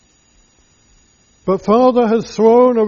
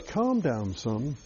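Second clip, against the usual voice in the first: tender calming; 3 semitones lower than usual. Acted. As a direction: soothe the angry son gently, near a hush. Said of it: "with great tenderness and with great affection".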